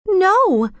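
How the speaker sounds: put-on high, emphatic girl's denial; pleading outburst leaping up in pitch; passionate protest. A narrator's reading. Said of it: "she protested passionately".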